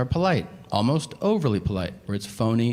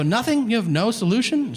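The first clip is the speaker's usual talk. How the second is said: high, disbelieving mock outrage; squeaky incredulous retort; 11 semitones higher than usual. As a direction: act stunned she has no answer. Mocking barista, incredulous.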